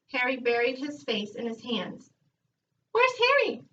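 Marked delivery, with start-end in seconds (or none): 2.9-3.6 high squawky parrot call. A narrator's reading. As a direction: squawk it out like a talking bird. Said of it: "repeated the bird".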